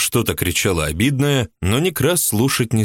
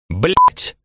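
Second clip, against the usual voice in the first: higher, louder angry outburst; flaring up; 8 semitones higher, 4 dB louder than usual. A narrator's reading. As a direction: spit it out in a sudden flare of anger. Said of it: "вспыхнула Улыба".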